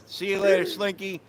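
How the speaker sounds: loud, high shout across the street; hollering greetings to friends passing by; calling out. Real, not acted. Greeting passing friends from the sidewalk, loud high hollering shout.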